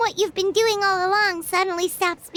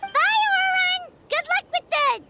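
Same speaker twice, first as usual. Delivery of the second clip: bright called-out goodbye; cheerful farewell call; 11 semitones higher than usual. Real, not acted. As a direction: sing it out to friends walking away. Calling goodbye after departing friends.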